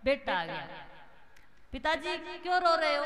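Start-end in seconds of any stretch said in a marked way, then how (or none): 1.7-3.0 higher son's voice. Acted. Son voiced asking father.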